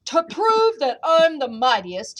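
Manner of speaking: booming storybook threat; bellowing giant. A narrator's reading. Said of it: "he bellowed".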